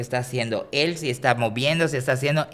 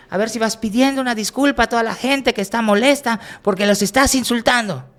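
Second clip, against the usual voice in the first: much higher, louder indignant demand; bossy lady demanding; 10 semitones higher, 5 dB louder than usual. Retold or imitated. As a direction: scold him on behalf of everyone. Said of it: "se acerca".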